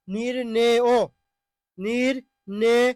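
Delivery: নির্ণেয় stretched very slowly, syllable by syllable; drawn-out pronunciation. Shown as a demonstration. Teacher drawing out নির্ণেয় for students. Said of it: "teacher repeating word slowly".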